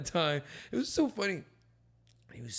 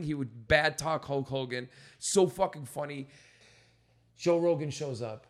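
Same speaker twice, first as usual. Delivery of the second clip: ordinary talk, nothing marked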